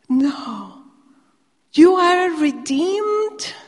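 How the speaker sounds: retelling an awestruck angel, voice soaring; excited, pitch going up. Retold or imitated. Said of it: "his pitch just went up with excitement".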